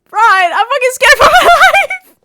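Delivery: very loud, high shriek breaking into laughter; comic terrified yell; mock panic. Acted panic at being yelled at.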